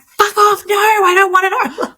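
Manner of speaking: a hammed-up hormonal teen outburst; teenage girl tantrum. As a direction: play a moody teen refusing juice. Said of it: "speaking from a girl's experience".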